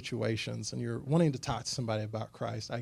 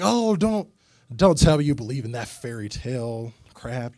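mocking boss; 8 dB louder than usual. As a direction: scoff at him like the mean boss. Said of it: "it turned into a pretty hateful thing".

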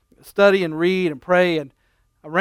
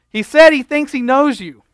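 loud repeating; 7 semitones higher, 6 dB louder than usual. Retold or imitated. Husband repeats loudly, laughing.

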